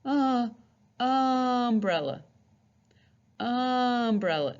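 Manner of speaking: umbrella with a short open uh. Shown as a demonstration. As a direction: stretch umbrella slowly on uh. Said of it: "Umbrella is a short U sound".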